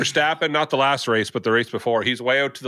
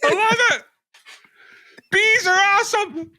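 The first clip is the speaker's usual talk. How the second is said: hammed-up enthusiasm. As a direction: gush it way up high. [name] plays up the mockery.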